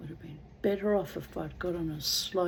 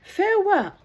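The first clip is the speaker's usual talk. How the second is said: high farewell call; 13 semitones higher, 9 dB louder than usual. Acted. Drowning man calls.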